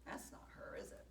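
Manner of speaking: hushed whispered aside; whispering. Real, not acted.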